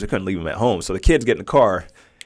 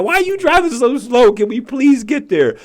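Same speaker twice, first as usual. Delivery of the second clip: put-on whiny impatient kid; 12 semitones higher, 5 dB louder than usual. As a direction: whine it like a kid stuck in the back seat. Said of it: "I was thinking the same thing".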